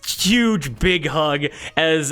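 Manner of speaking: ordinary talk, nothing marked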